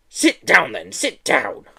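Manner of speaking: a touch of a nasty teacher's scold; lightly voiced scolding schoolmistress. Miss Hatchet scolding Joe for gawping, mildly nasty. Teacher orders new boy.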